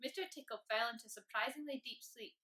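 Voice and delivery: ordinary delivery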